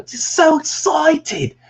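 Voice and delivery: very high, loud, drawn-out excited squeal; Mike Myers. Acted. Creator selling that juice machine, hammed-up excited squeal. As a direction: squeal slowly like a giddy cartoonish character. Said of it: "there's always a Mike Myers ref".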